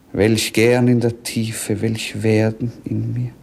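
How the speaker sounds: ordinary talk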